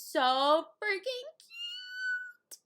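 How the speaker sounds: high, stretched-out squeal of affection; gushing adoration. Real, not acted. Owner remembering her new puppy, gushing adoration. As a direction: coo it, dragging out the last word.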